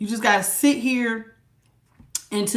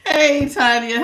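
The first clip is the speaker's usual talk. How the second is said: ordinary delivery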